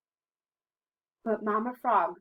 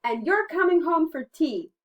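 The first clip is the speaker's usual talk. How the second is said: high, loud storybook mother; raised, playful parental order; mama frog; 8 semitones higher, 10 dB louder than usual. A narrator's reading. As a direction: firmly call a little one home. Mama Frog orders Baby Frog home.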